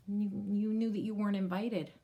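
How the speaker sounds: ordinary delivery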